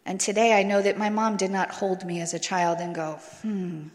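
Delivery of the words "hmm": a scheming whisper, much softer; mock sinister whisper. Imagining mom plotting over her baby, mock sinister whisper. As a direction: hush it like a cartoon plotter.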